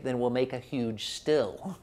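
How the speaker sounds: hushed smug one-upmanship; defiant Irish comeback. Retold or imitated. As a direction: say it under your breath like a clever dodge. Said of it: "which the Irish respond to by saying".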